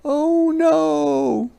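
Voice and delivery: high, loud alarmed oh no; alarmed cry. A narrator's reading. Hedgehog sees paper flying at her, high alarmed cry. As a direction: cry out in fright. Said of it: "she cried".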